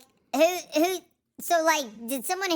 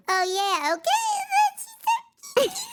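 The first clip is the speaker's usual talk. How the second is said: climbing higher and higher, squeaky; rising chipmunk voice. Retold or imitated. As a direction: let the voice creep up into a squeak. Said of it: "first she'll be like".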